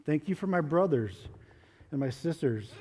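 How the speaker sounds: simple reverent prayer. Acted. Leads children in prayer.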